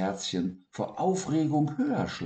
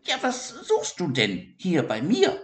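high, gruff hedgehog challenge; unfriendly; 10 semitones higher than usual. Acted. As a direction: push the question out curtly. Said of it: "nicht gerade freundlich hervor".

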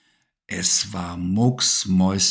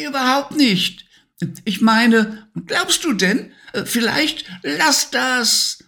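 very high, quarrelling toy; squeaky toy voice. A narrator's reading. A toy squabbling with Simba over Levi, high squeak.

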